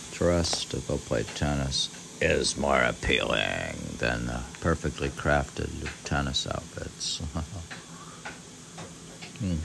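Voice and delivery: normal talking voice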